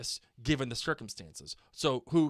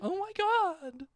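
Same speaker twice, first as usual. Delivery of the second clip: much higher, slow gushing oh my God; drawn-out high fan squeal; excited squeal; slower than usual. Pretend. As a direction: gush like an excited twelve-year-old. Acting listener excitement.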